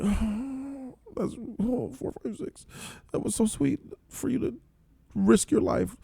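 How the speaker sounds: high, soft, near-tearful voice; choked-up reaction. Retold or imitated. A viewer moved to tears by 456's kindness, choked up. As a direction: voice breaking with emotion.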